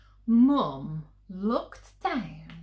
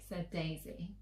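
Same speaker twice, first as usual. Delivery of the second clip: ordinary delivery, nothing marked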